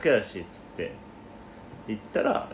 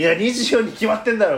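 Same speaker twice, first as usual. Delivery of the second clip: indignant mock retort; 10 semitones higher, 5 dB louder than usual. Acted retort about his age.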